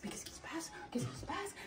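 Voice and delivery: puzzled whisper. Acted. René whispering to himself, puzzled. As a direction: whisper it to yourself, wondering. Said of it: "René se dit".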